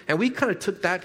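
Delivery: normal talking voice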